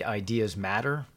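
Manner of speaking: normal talking voice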